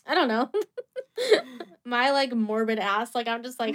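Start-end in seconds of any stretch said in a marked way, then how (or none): 0.5-1.6 sudden high laugh; high burst of laughter. Real, not acted. Laughing at own morbid joke.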